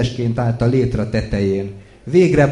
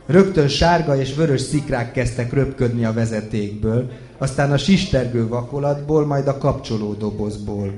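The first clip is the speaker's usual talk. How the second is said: plain reading voice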